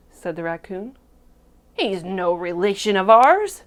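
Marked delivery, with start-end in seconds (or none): 1.7-3.6 loud, affronted frogs; indignant declaration. A narrator's reading. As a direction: declare it firmly, disowning the pig. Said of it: "declared the frogs".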